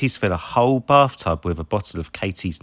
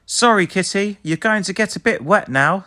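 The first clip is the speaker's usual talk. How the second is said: much higher, gentle apology; apologetic; 10 semitones higher than usual. A narrator's reading. Marshall apologising to the kitty, higher. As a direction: say sorry kindly. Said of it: "says Marshall".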